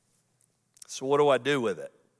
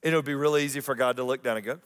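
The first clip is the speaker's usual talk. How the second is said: ordinary talk, nothing marked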